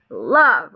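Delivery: love said long and punched; emphatic bold word. Shown as a demonstration. Teacher repeats the bold word for scholars, emphatic love. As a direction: give love extra oomph, then let it fall. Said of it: "like that".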